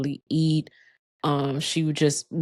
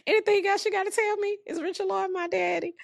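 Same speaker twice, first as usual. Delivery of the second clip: fast, much higher comic jab; joking tease; much faster than usual. Retold or imitated. Joking with her dying mom, a fast high tease. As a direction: rattle off the joke quickly, squeaky and teasing. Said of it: "just joking with her".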